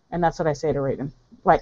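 normal talking voice

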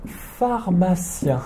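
slow, careful "pharmacien"; slow model word. Shown as a demonstration. A teacher introduces the word "pharmacien" to students. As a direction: stretch out "pharmacien" for learners. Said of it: "Mazu, le".

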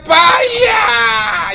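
high, long, loud shout; drawn-out shout. Real, not acted. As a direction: yell the goodbye, stretched long. Shouting goodbye to android.

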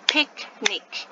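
Picnic split into PIC, then NIC; two separate, even beats for Picnic; syllable by syllable. Shown as a demonstration. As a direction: sound out Picnic with a gap after pic. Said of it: "how many syllables are in it".

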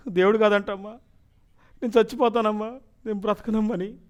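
clinging to mother in despair; retold sobbing plea; crying to mother. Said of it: "పట్టుకొని ఏడుస్తుంటే".

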